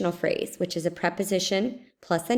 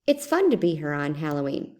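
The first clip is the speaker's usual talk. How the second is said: ordinary delivery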